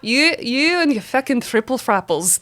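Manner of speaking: slow, deliberate Irish accent. Shown as a demonstration. Actress performs her drama-school scene line, Irish accent. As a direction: recite it in stage Irish. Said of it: "I'll say the line from the scene".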